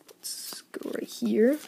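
slow, unvoiced whisper. Real, not acted. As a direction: whisper it slowly. Quietly opening pack.

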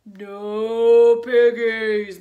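loud, very slow lowing voice; mooing cow. A narrator's reading. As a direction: cow refusing the witch. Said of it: "mooed the cow".

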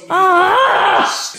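high, drawn-out yell; loud scream. Real, not acted. Quiz viewer reacts to the answer, loud high scream. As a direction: scream out at the reveal.

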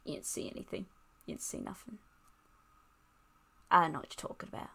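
hushed. Real, not acted. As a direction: hush it like a cover-up. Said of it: "I'm such an idiot".

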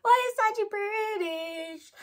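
high, slow whining lament; hammed-up tearful whine, drawn out; mock wail. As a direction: wail it out, stretching BRITISH. Wailing her gripe after laughing.

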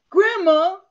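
put-on high, loud kid whine of "Grandma"; whiny grandchild. Grandson pouting to grandma, high whine. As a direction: whine like a pouting six-year-old.

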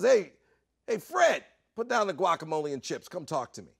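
loud, high hail, then settling; commanding call to a guest; calling someone over. Retold or imitated. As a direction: summon him from across the room. Host calls guest across party.

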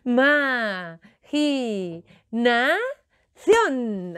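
IMAGINACIÓN stretched very slowly, syllable by syllable; drawn out syllables. Shown as a demonstration. Storyteller leading children to repeat the magic word, syllable by syllable. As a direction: chant "Imaginación" slowly, each syllable held long. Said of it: "repite conmigo".

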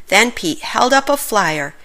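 usual speaking voice

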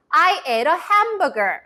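very slow, clearly spaced sentence; slow model sentence. Shown as a demonstration. Teacher modelling the sentence for kids to repeat aloud. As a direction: say it slowly for repeating. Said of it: "선생님 따라서 얘기해 볼게요".

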